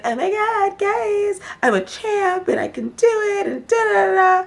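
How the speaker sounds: very high, hammed-up whiny imitation; mock gushing squeal. Mimicking a castmate saying she's going in, mock squeal. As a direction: squeal it like a badly faked teary pep talk.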